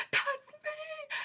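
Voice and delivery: high-pitched, loud fan squeal; retold freaking out. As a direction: squeal it out to claim the moment. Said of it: "I start freaking out".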